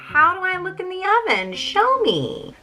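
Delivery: very high, drawn-out clueless whine; playing dumb Gretel. Gretel fooling the witch into showing her, playing dumb. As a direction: put on exaggerated helplessness, way up high. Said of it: "Gretel decides to play dumb".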